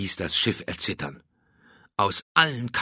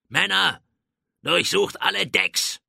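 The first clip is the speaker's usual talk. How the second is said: high, raised commander's voice; called-out order; 10 semitones higher than usual. A narrator's reading. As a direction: call the order to all the men. Drake orders his men.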